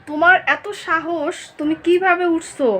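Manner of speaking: drawn-out, slow half-stern scolding; slow mock scolding. Real, not acted. Mother slowly teasing-scolding her climbing daughter. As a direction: stretch each word slowly like a playful telling-off.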